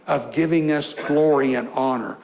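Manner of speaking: normal talking voice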